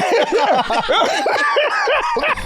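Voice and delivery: drawn-out laughter; long high laugh. Real, not acted. Hearing his own text quoted back, long laugh.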